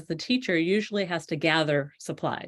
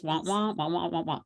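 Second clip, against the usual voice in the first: muffled wah wah talk; 4 dB quieter than usual. Shown as a demonstration. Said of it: "that's what it ends up sounding like".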